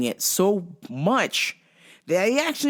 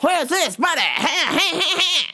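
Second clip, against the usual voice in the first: pushy customer; 7 semitones higher than usual. Retold or imitated. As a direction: pester like an impatient customer. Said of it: "And he goes".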